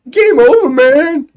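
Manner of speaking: high, loud panicked yell; panicking. Retold or imitated. Quoting the Aliens line as a panicked scream. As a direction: shriek it like a panicking soldier.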